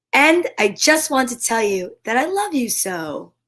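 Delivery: slow, tender picture-book reading; reading to children. Acted. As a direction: read it slowly to a little one.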